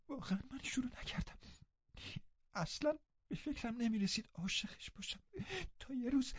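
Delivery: high, breathless whisper through tears; tearful whisper; whispered sobbing. Acted. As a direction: whisper the confession, heartbroken. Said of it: "با حقحقی".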